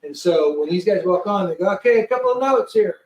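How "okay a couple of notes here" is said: lightly acted crew briefing, pitched up; mock announcement to the passengers, higher; brief them like flight crew.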